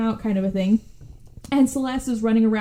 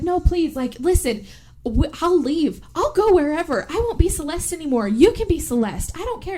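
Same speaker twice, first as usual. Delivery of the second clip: high, urgent mock begging; hammed-up frantic plea; acted pleading; 7 semitones higher than usual. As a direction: bargain desperately in a higher voice.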